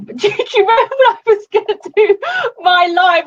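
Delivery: giggling through the question, voice jumping high; laughing. Real, not acted. Laughing as she reminds her coach of her first live.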